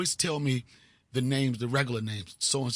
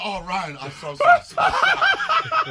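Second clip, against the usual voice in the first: high, loud, drawn-out "so sorry" through laughter; whimpering apology, laughing at himself; mock pleading apology; 9 dB louder, slower than usual. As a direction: grovel in a squeaky panicked voice. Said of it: "I was like".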